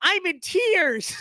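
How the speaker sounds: high, gushing mock tearfulness. Vlogger mocks a gushing liberal tweet, high mock sobbing. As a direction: overdo the sentiment, mock crying.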